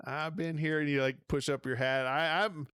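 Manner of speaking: hammed-up cowboy country accent. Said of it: "We're talking with an accent".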